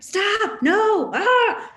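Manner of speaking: high, loud panicked parent yell. Retold or imitated. Mom stopping her son from hurting his brother, yelling. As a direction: yell sharply to halt a child. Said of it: "I yell at him".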